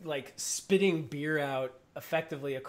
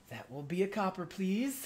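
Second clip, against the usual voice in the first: higher, polite barmaid; 5 semitones higher than usual. Acted. Barmaid collecting the copper, politely higher. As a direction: sweetly ask for payment. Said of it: "She goes".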